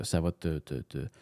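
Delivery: ordinary delivery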